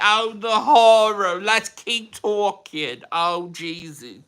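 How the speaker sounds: high, slow, drawn-out Brooklyn mom; Brooklyn mom accent. Pretend. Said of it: "Brooklyn mom accent".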